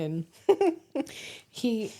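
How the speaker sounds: normal talking voice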